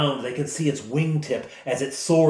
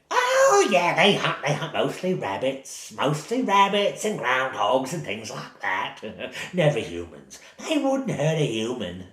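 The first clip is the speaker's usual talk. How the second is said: put-on sweet old woman voice. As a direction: croon it like a harmless granny hiding something.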